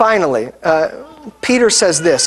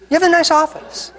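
higher, lightly voiced compliment to the pastor; a hint of a sheepish younger self; awkward small talk; 8 semitones higher than usual. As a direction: offer a polite nicety to fill the silence. Retelling his own awkward small talk.

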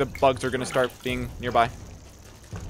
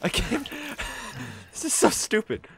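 shaky nervous laugh; 11 semitones higher, 3 dB quieter than usual. Real, not acted. Shaken after boss fight.

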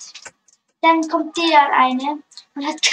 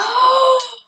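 excited gasp; 9 semitones higher, 6 dB louder than usual. Real, not acted. Gasps at dream pet.

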